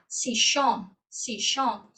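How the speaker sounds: "sichón" with the Argentine sh sound for ll. Shown as a demonstration. Teacher warning travellers about Argentine "sichón". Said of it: "com som de chá".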